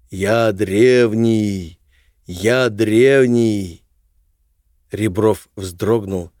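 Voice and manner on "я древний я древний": the same words as a distant echo; echoing ethereal energy being. Acted. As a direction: let it ring back like an echo. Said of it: "словно эхо подхватило этот голос". (эхо древнего голоса)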